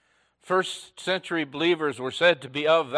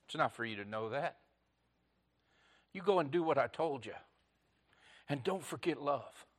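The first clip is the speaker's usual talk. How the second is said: much softer and quick, hushed; soft gentle reply; 11 dB quieter, faster than usual. Acted. Jesus answering his disciples, soft quick hush.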